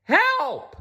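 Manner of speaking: high, loud cry for help; shout for help. Acted. Muddy Blinky shouts for help as he flees. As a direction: yell for help in panic. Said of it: "shouted a very muddy Blinky".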